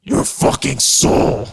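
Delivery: slow, whispered menace; demonic whisper. Pretend. Player voicing the satanic unicorn's menacing reply, slow whisper. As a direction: hiss it slowly like a demon.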